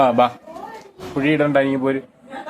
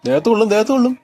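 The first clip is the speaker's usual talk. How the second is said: alarmed warning; 8 semitones higher, faster than usual. Real, not acted. Warning child about sharp tool.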